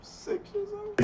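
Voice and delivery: high, slow squeal of disbelief; shocked. Real, not acted. Host reacts to Joey seeing a body at six, shocked. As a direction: stretch it out, can't believe it.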